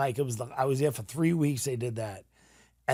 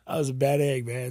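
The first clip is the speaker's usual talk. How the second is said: ordinary talk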